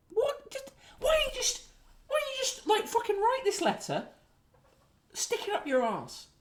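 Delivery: high, squeaky mock-tearful rant; exasperated. Host answers a petty letter writer, exasperated high squeak. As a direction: squeal it softly, as if despairing.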